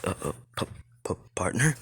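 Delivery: hesitant, halting disbelief rising to a question; stunned stammer. Acted. Drake recognising his lost partner, stunned stammer. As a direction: stutter softly, barely getting the word out.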